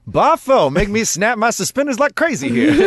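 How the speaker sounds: high, loud vintage showman; old-timey voice. Pretend. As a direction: ham up a 1920s showman.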